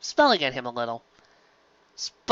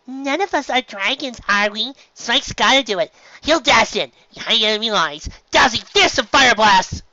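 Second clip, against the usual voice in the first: bubbly Pinkie Pie; 9 semitones higher, 6 dB louder than usual. Acted. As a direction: stretch it out in a high cartoon voice. Pinkie voiced in story.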